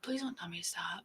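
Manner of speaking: soft, voiceless mock-hurt whisper; 들리지 않게 속삭이듯 부탁; whispered plea. Real, not acted. Told to stop, mock-hurt reply.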